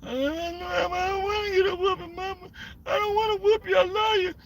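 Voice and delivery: high, soft hammed-up sobbing; mock crying kid. Kid sobbing to his mama not to whoop him. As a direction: blubber like a kid begging not to get whooped. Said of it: "And I started crying, too".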